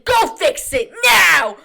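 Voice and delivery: slow, loud furious shout; angry shout. Retold or imitated. Customer berating the ice cream worker, loud shout. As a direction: yell it at the worker slowly. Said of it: "starts cussing him out".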